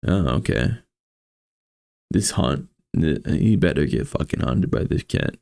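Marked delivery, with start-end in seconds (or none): none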